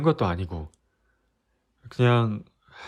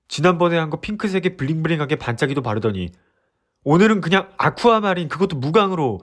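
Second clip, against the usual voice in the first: higher, louder, rapid scolding; exasperated rant; 6 semitones higher, 3 dB louder than usual. Acted. Boyfriend listing girlfriend's changes, loud and exasperated. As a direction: nag her out of frustrated care.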